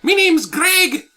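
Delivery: put-on Irish accent. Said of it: "I don't think you're Scottish".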